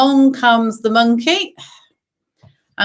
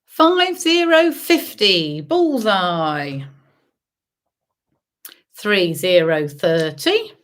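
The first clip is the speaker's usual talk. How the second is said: sing-song number calling; bingo caller; 3 semitones higher, much slower than usual. Acted. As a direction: call each number out, drawn out. Calls bingo numbers to players.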